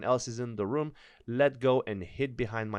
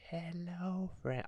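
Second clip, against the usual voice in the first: a soft, lightly voiced creepy figure; mild spooky monster voice; deep slow creepy voice; 5 semitones higher, 11 dB quieter, slower than usual. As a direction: hush it like something in the dark. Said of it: "The voice was deep, and it seemed to say it slow".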